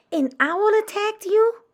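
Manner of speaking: high, loud startled question; alarmed question. Acted. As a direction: ask in alarm. Mother bat asks in alarm.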